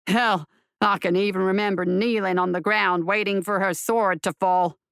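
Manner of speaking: low-voiced marshal recounting his fall; marshal in penance. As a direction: tell your horse about your punishment, low.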